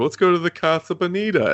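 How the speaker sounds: high, bright naive tourist line; gullible tourist. Retold or imitated. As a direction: chirp it like a clueless visitor. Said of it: "They're like".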